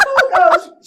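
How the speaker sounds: loud, high gasp through laughter; helpless laughing. Real, not acted. Reader reacting to the fart in his face, laughing hard. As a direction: crack up while exclaiming, really losing it. Cracking up at the story.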